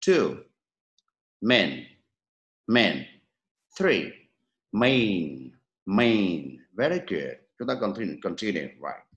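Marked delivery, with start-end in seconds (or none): 1.4-3.0 "Men" with a brief, relaxed E; short vowel. Shown as a demonstration. Teacher contrasts the second column sound, short relaxed vowel. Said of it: "cột thứ hai và cột thứ ba để có sự so sánh". 4.7-6.6 "main" slowly, E gliding into Y; diphthong. Shown as a demonstration. Teacher models the last column sound, long gliding diphthong. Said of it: "cột thứ ba để có sự so sánh".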